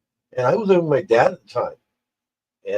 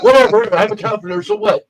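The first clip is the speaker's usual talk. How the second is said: high, loud, giddy and loopy; drugged-up carefree; 12 semitones higher, 5 dB louder than usual. Retold or imitated. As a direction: toss it off, high as a kite. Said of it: "I was like".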